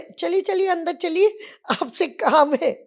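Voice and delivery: high, loud, bustling welcome; hearty invitation. Retold or imitated. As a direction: usher them in boomingly. Ray bustles inside. (घर में घुसते हुए ऊँची, ज़ोरदार आवाज़ में बुलाना)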